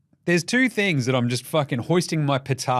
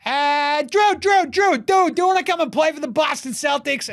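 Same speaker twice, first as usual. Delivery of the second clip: eager recruiters calling out; 11 semitones higher than usual. Acted. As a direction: call Drew over excitedly in a put-on high voice. Acted team calling out to Drew.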